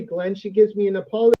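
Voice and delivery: usual speaking voice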